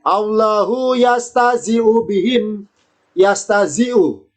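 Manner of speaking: slow careful Arabic recitation of yastazi'u; Arabic example recited. Shown as a demonstration. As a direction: spell out "yastazi'u" slowly, each syllable clear. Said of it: "Contoh lagi".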